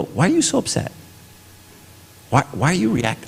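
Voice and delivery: high-pitched imitation of a probing child; questioning kid. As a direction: lightly voice a kid challenging a parent. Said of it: "he's gonna go".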